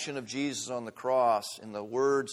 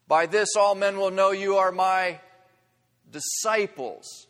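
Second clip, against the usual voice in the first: emphatic scripture quote; 7 semitones higher, 9 dB louder than usual. Acted. Quoting the verse with force.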